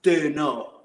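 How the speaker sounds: put-on a British rock-star English accent as Saddam; lightly done English accent, a punk Saddam defending himself; a mild British accent with a punch on do; Saddam Hussein English accent. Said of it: "Saddam Hussein English accent".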